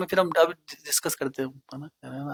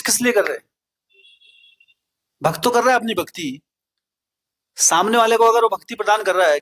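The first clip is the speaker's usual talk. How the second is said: higher, louder preaching push; fervent preaching; 5 semitones higher, 4 dB louder than usual. Acted. Preacher urging the gathered devotees, fervent and raised. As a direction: drive the point home to the congregation.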